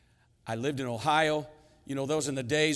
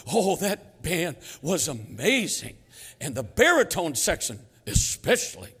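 mock-gushing proud father, big stressed words; hammed-up fatherly raving; bragging dad; 3 semitones higher, 5 dB louder than usual. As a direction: gush over the band, punching key words. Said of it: "He would always brag about".

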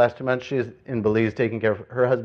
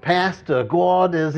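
higher-pitched imitation New York accent; 5 semitones higher, 4 dB louder, slower than usual. Preacher quoting church sister to her pastor, New York accent. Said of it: "in her charming New York accent".

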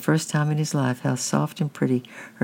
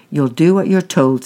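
louder, firm parental command; stern order; 3 semitones higher, 8 dB louder than usual. A narrator's reading. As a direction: shut down the child's pleading. Stepfather overrules pleading boy, slams suitcase.

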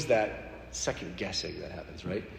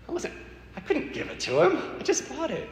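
sheepish laugh, much higher; laughing sheepishly. Real, not acted. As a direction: giggle through the excuse, pitched up high. Laughing about not giving Bible.

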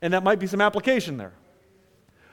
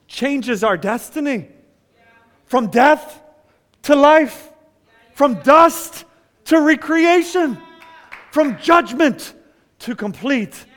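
higher, louder, slower on each declaration; preaching build; 4 semitones higher, 5 dB louder than usual. Acted. Pastor to church, building to a preached climax. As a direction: preach it, lifting each phrase.

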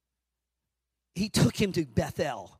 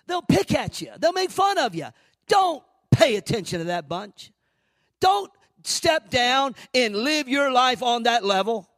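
higher and louder, shouting the warning; fiery preaching; 8 semitones higher, 7 dB louder than usual. Acted. Preacher warning the congregation against mockers, shouting. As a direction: build it to a full preaching cry.

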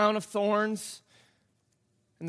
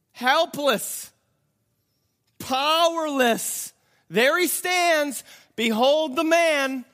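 higher, slow, pleading showman; Pilate presenting Jesus; 8 semitones higher, 5 dB louder, slower than usual. Acted. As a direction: make the crowd look at him. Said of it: "And he says".

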